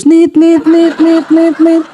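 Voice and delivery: monotone repeated phone beeps; imitated disconnection tone. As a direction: mimic the cut-off line with even, high beeps. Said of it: "Teleponnya langsung putus". (tiruan nada telepon terputus)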